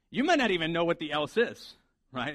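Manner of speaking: ordinary talk, nothing marked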